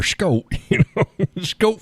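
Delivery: "Scott" mangled into Skope; botched town name. Shown as a demonstration. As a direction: say "Scott" with a long O, as if misreading it. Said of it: "I'll screw it up".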